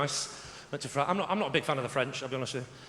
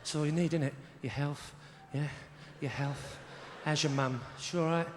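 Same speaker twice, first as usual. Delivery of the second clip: put-on friendly-threatening London accent; 8 semitones lower than usual. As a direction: make London small talk sound like a threat. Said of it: "the more threatening it becomes".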